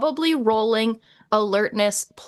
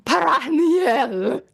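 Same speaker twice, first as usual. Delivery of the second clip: slow haughty drawn-out Baraniel; snooty elf voice; 6 semitones higher, much slower than usual. Shown as a demonstration. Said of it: "the most snooty elf way".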